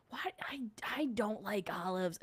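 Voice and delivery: whispered aside; breathy unvoiced whisper of a confession. Real, not acted. Aside about olives.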